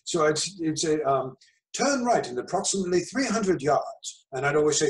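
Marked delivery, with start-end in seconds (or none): 1.7-4.2 slow, higher British navigation voice; British GPS voice. Retold or imitated. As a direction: read it like a British sat-nav, slowly. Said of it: "set it to the British English".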